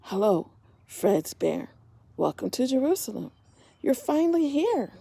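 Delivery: gentle storybook narration; reading to children. A narrator's reading. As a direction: tell it like a bedtime story to little ones. Reading picture book aloud.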